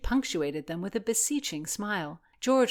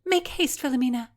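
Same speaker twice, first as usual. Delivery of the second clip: urging; 8 semitones higher, 6 dB louder, faster than usual. Acted. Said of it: "Constance urged".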